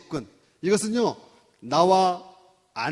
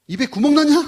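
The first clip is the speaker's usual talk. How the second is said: loud, high mocking insult; sharp jab; 11 semitones higher, 13 dB louder than usual. Wife mocking her messy husband, loud and sharp. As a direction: hurl it like a sarcastic attack.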